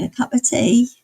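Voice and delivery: a light cockney "A cup of tea" with "of" slurred to "a"; Eliza Doolittle cockney. Retold or imitated. Streamer quotes a chat sound bite, light cockney imitation. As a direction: lightly mimic an unschooled flower girl saying "A cup of tea". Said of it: "it's Eliza Doolittle saying".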